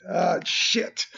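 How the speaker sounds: high groan into a sharp curse; frustrated swearing. Real, not acted. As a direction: curse at your own mistake. Something goes wrong, then apologizes.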